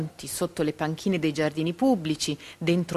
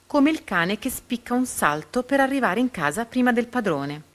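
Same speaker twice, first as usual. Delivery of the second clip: plain reading voice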